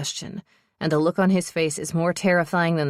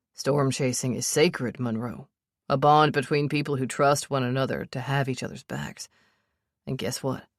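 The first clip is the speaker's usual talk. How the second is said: a hint of a lower, steady man's voice, protective and teasing; lightly voiced Sebastian; 3 semitones lower, 3 dB quieter than usual. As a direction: lower him just a touch, calm and sure of himself.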